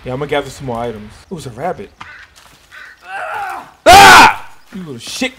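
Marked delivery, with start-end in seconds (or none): 3.0-4.6 high, loud sudden cry; startled shout. Real, not acted. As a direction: yelp as the rabbit bolts. Chasing a rabbit.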